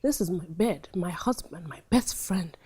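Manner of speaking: low, soft, almost whispered; inner denial. Shown as a demonstration. Teacher voicing the wife's inner mantra, hushed disbelief. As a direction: say it under your breath, stunned. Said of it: "in your head, you're going".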